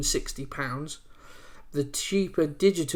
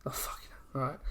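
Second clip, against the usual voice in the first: whispered groan; 11 dB quieter, much faster than usual. Real, not acted. Groan at a late payday.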